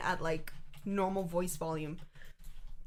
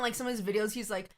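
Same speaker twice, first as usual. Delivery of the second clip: usual speaking voice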